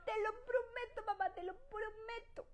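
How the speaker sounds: put-on high childish pleading voice; child's promise. Children promising their mother, high and whiny. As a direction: whine like a child promising mom. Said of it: "Así dicen los niños".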